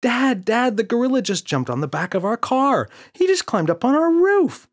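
put-on very high, loud child yell. Boy yelling to father in the car. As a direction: yell to get dad's attention. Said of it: "yelled James".

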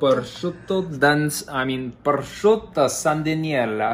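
rolled Italian pronunciation of prosciutto; Italian accent. Pretend. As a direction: say it like an Italian chef. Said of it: "you have such a good accent".

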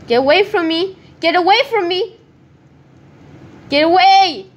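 frantic shout at a chasing player; panicked yell. Real, not acted. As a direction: yell him off, building. Fleeing a scary player.

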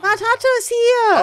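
loud, slow, high cheer; excited greeting. Real, not acted. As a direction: call out the arrival with glee. Friends greet arriving player.

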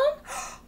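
high sharp gasp of mock surprise. Teacher showing kids mock surprise with a gasp. Teacher gasps at full tree.

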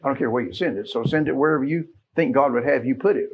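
usual speaking voice

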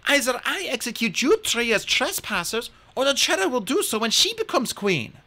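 firm queen laying down the law; the queen coldly refusing Hazel's help; stern Amazon queen. Acted.

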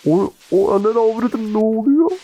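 high, loud terrified cry; real fright, voice cracking up high; panicked cry. Real, not acted. Chased by monster, panicking.

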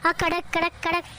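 mother gulping water to free her throat, noisy swallows; gulping water sounds. Acted. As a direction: swallow noisily, gasping at the start.

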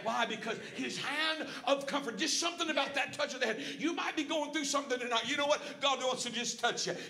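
impassioned sermon voice; fervent preaching. Real, not acted. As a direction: preach it out with punch on key words. Preaches with rising force.

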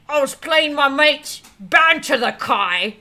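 loud, badly faked Scottish accent; hammed-up Scottish, close to laughing; mock Scottish accent. Streamer showing how they would say it, mock Scottish accent. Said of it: "So I would say".

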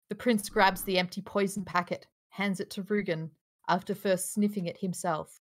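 ordinary talk

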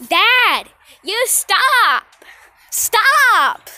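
shouting. Real, not acted. Yelling at dad.

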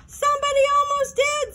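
a bitter, high-pitched retort. A narrator's reading. Benji blames Mark for the near disaster, bitterly. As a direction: spit it out with resentment. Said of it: "said Benji bitterly".

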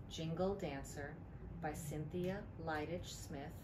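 ordinary delivery

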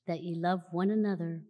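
ordinary talk, nothing marked

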